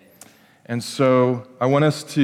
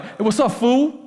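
mock gangster greeting; 8 semitones higher than usual. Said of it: "gangster talk".

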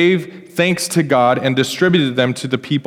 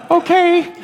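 mock squeaky okay. Joking reaction to giving everything.

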